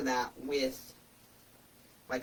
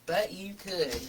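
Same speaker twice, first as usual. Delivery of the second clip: dry repeat; 3 semitones higher, slower than usual. Real, not acted. Repeating the tease to viewers, dry and knowing. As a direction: say it again deadpan, just between us.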